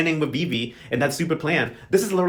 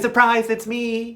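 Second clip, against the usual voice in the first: mock sweet surprise; 7 semitones higher, much slower than usual. Fabiana returns to the convent.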